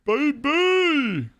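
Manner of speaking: hyped shout-out. Real, not acted. Streamer hyping his city to chat, excited cheer. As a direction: cheer for New York like a hype man.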